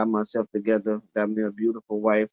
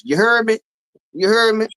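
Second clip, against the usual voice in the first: imitated verbal tic; 9 semitones higher, 5 dB louder than usual. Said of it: "after every word".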